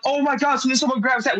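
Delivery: urgent, high exclamation, lightly acted; retold panic. Retold panic as third cobra appears.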